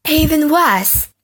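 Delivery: slow, gasping horror; gasp, then dread it; Henry realizing the worst, gasping; gasp of dismay. A narrator's reading.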